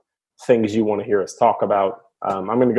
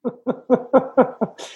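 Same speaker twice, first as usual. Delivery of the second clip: high burst of real laughter; delighted laugh; 12 semitones higher than usual. Real, not acted. Cohost bursting into high laughter after the story ends.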